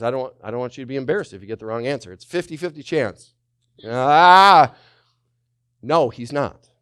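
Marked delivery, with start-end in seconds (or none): 3.8-4.7 mock buzzer shout. Mock buzzer for wrong answer.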